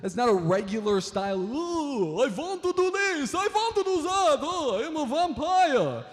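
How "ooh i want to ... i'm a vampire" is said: very high, swooping mock Dracula accent. Comic mocking that one guy's vampire voice, high hammed-up Dracula accent. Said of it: "what is that accent? That's one guy's accent".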